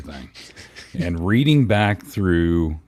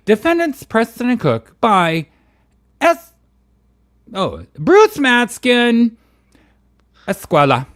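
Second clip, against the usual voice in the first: high, loud theatrical character reading; hammed-up high voice, drawing the names out; Bubbles; 12 semitones higher, 5 dB louder than usual. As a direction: announce the names in high, campy swoops. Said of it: "read this in your Bubbles voice".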